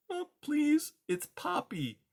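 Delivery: tiny timid mouse voice, high; timid little mouse. Acted. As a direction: squeak it out like a scared mouse. Poppy speaking.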